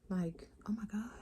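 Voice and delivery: breathy whispered gush. Real, not acted. Mother gushing to viewers about her kids, whispered. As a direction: whisper it like a happy secret.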